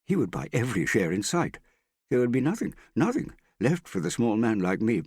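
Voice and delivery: worked-up grievance; heated. A narrator's reading. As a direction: complain urgently, pitch climbing. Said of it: "I apologize if I sounded heated".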